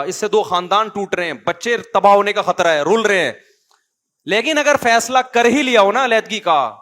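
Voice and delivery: forceful preaching. Acted. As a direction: drive the message home to the listeners. Preaching at full force on divorce. (پرجوش خطیبانہ انداز)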